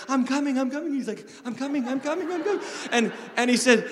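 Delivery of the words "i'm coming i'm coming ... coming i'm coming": put-on higher, breathless little-kid voice with a laugh; imitating little son. Dad imitating his small son hurrying along, higher and laughing. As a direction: play the puffing six-year-old, higher. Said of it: "so cute 'cause he's like".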